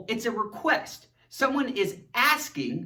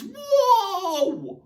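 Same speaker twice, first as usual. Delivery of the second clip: mock amazement; 10 semitones higher than usual. Shown as a demonstration. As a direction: gasp it out big. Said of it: "expresses intense emotions".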